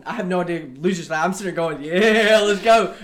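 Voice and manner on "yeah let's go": loud, raised party shout; rowdy cheer. Retold or imitated. Oblivious friend cheering beside Dalton, rowdy. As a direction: cheer it on at full volume.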